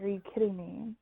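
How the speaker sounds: soft, understated incredulity; muted disbelief. Retold or imitated. Mom stared at by the girls, hushed disbelief. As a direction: drop the volume, barely believing it.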